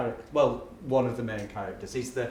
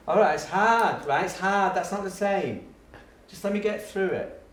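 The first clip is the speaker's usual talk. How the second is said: higher, placating insistence; pleading teacher; 6 semitones higher than usual. Retold or imitated. Teacher begging bored pupil for patience, pleading.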